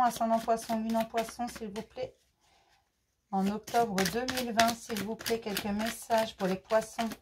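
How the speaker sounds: quiet tarot incantation, trailing off on s'il vous plaît; murmured invocation. Real, not acted. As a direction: repeat the plea softly like a litany.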